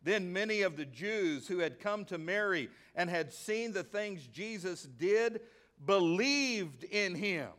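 usual speaking voice

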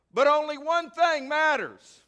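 high, loud "only one thing matters"; preacher proclaiming the one thing to the church; proclaiming loudly. Acted. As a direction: ring it out like the sermon's peak. Climax of the quote.

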